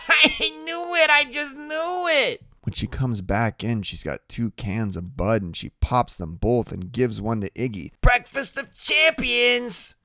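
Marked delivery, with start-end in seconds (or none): 0.1-2.4 very high, loud, gleeful cry; gleeful Loretta. A narrator's reading. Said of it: "we can hear her laughing and saying". 8.0-10.0 very high, loud, slow toast; Loretta, merry. Acted. Loretta cheerfully hands out beer.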